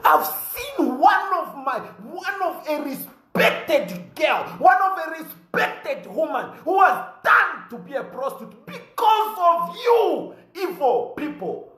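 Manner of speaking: rising to a shout at the president; angry denunciation. Real, not acted. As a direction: blast the president, voice climbing high. Denouncing the president to crowd.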